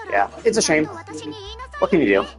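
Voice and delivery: ordinary talk, nothing marked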